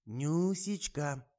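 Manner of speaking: put-on slow, high quavering old woman. A narrator's reading. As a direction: call out like a trembling granny. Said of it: "дребезжащим голосом окликнула".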